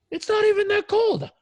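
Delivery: high, loud, elated shouting; amazed relief. Retold or imitated. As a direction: imitate a client hollering his surprise from the shower. Retold client yelling in sudden relief.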